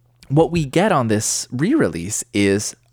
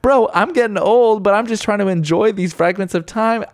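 higher and louder, gushing bro; excited exclamation; 9 semitones higher, 3 dB louder than usual. Retold or imitated. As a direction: fan pleading with himself over the song, excited and higher. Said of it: "And I'm like".

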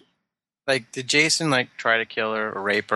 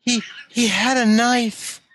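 overacted scared girl; mock damsel voice; 12 semitones higher than usual. Mocking the bad actress's line.

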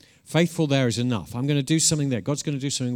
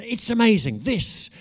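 much higher and louder burst on "amazing"; emphatic excitement; 11 semitones higher, 6 dB louder than usual. Real, not acted. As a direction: throw "amazing" up high and loud. Preacher repeating with more force.

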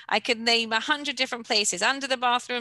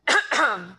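a real, loud, rough clearing of the throat; 6 dB louder than usual. Real, not acted. Teacher to class, fighting a cough, loud throat clearing. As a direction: clear your throat hard, you can't stop coughing. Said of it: "I don't know why I'm coughing so much tonight".

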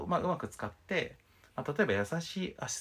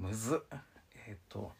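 ordinary talk

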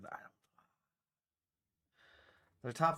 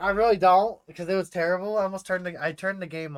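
louder, higher venting about the game; loud exasperation; 6 semitones higher, 11 dB louder than usual. Real, not acted. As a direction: blurt out your disgust. Admits hating the game.

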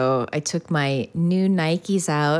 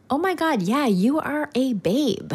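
hammed-up smitten admiration, pitched up; admiring; 8 semitones higher than usual. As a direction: gush over someone gorgeous, playfully. Acted admiring reaction to gorgeous woman.

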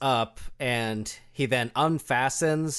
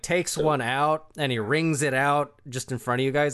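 ordinary talk, nothing marked

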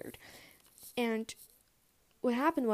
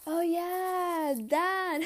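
higher, awkward little "oh yeah, that"; 7 semitones higher, much slower than usual. Retold or imitated. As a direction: play it off awkwardly. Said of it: "I was just like".

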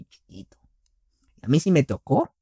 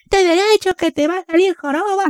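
much higher, nagging mock mother; nagging mom; 4 dB louder than usual. Son imitating mom scolding his slouch, high and nagging. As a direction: squeal the warning about a hunchback. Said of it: "Cuando te veían jorobadilla ahí".

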